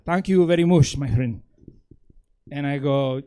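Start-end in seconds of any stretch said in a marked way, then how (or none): 0.0-1.4 exaggerated Mexican-accented English, louder at the start; heavy Mexican accent. Professor thanking his student translator, in thick Mexican accent. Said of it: "the biggest Mexican accent he could muster".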